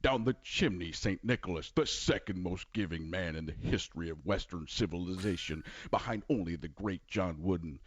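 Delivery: Bill Walton impression. Impressionist doing Bill Walton, low and wandering. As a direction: drift off into grand praise. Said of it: "Bill Walton".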